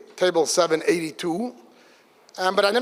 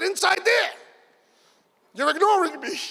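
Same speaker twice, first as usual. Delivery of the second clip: accusing shout; 10 semitones higher than usual. Retold or imitated. To Yankel, an outraged high accusing shout. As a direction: fire the accusation at him.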